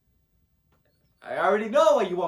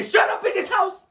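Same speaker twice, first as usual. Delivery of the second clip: very high, loud yell; high-pitched commanding shout; excited shout; 6 dB louder than usual. Acted. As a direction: yell over a whole party. Hushes the whole party.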